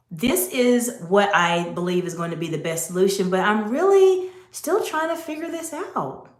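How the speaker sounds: statement turned into a question, voice going up at the end; rising at the end. Shown as a demonstration. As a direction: sound unsure by lifting the last words. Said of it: "see what I did with my voice? My voice went up at the very end".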